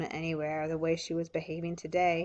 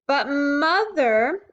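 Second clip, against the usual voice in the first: put-on high, slow, pleading child's voice; urgent little girl; 7 semitones higher, 8 dB louder, much slower than usual. A narrator's reading. Ramona urgently pleading with Mother, high and slow. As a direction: stretch it out like a desperate little girl. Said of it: "insisted Ramona even more urgently".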